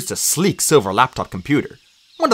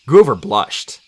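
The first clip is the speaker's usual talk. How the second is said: ordinary delivery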